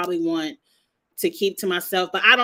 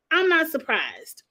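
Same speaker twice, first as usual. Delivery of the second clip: "I'm not surprised" raised much higher, landing on a fall; pitched-up repeat for emphasis; emphatic repeat; 6 semitones higher than usual. Shown as a demonstration. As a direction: hit "I'm" up high, then drop.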